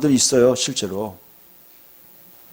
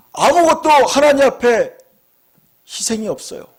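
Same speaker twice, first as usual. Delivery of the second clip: preaching emphasis; 8 semitones higher, 3 dB louder than usual. Acted. Preaching climax, raised voice. (아무것도를 높고 느리게 강조)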